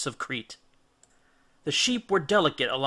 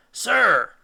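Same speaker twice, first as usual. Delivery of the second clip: a loud, high cry; cried out; 8 semitones higher, 8 dB louder than usual. Acted. Odysseus crying out to the Cyclops, loud and sharp. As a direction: call out to stop him. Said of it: "cried Odysseus".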